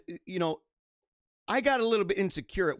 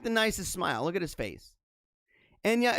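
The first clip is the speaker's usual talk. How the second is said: usual speaking voice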